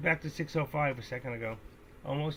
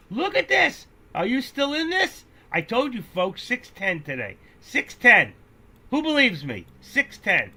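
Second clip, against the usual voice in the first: much higher and louder, hyped; excited shouting; 10 semitones higher, 11 dB louder than usual. Real, not acted. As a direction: yell at the chart in triumph. Hyped over stock climbing.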